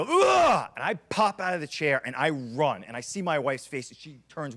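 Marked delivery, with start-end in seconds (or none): 0.0-0.7 put-on high, loud guttural baby groan; a sharp guttural baby sound heard from the bedroom; imitated baby's cry. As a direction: mimic a baby's choked cry from upstairs. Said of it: "So I hear, like, a".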